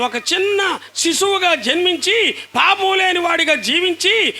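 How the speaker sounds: high-pitched, driving sermon climax; full-force preaching. Acted. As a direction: proclaim it to the whole congregation in long, ringing phrases.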